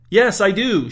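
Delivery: shouted protest. A narrator's reading. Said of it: "shouted Raya".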